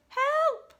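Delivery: high, loud acted scream; cry for help. A narrator's reading. Other sister panicking at water monsters, high loud shriek. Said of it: "the other one cried".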